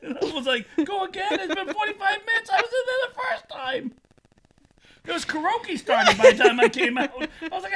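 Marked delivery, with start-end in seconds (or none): 0.8-3.9 high-pitched, disbelieving complaint; incredulous protest. Retold or imitated. Wrecked drinker to his friend who suggests another round, incredulous. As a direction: protest it in a much higher voice.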